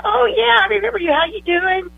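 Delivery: put-on light up at seeing an old client; delighted greeting.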